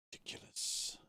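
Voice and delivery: breathless whispered scoff; whispered disbelief. Real, not acted. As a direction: hiss it under the breath.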